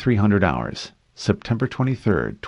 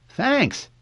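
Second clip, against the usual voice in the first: put-on high-pitched kid's voice; quick boyish thanks; cheerful young boy; 3 dB louder than usual. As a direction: chirp it like a child. Boy thanks before running off.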